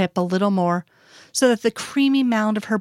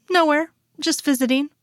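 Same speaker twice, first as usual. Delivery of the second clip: innocent; 8 semitones higher than usual. Acted. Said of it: "all but innocently".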